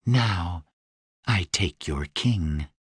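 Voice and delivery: low, smooth and seductive; sultry Deva voice. Acted. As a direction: purr it like a dangerous winged queen. Deva character voice.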